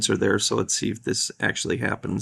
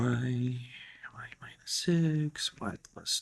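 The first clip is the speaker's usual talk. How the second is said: whispering; 11 dB quieter, much slower than usual. Real, not acted. Working math under breath.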